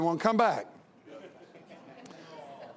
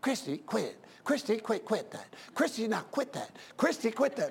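soft, repeated scolding, kept under her breath; quietly nagging mother in church; mother's hushed scolding; 6 dB quieter than usual. Retold or imitated. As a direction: mom hushing a child in the pew. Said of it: "And the mom's going".

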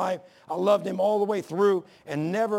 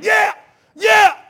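full-throated yell of excitement; screaming; 15 dB louder than usual. Retold or imitated. Preacher reliving yelling along to a sermon in his car, full-throated yell. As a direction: roar it out at the top of your lungs. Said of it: "I'm riding down the road screaming".

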